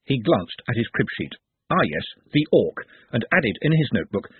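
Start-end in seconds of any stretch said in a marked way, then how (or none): none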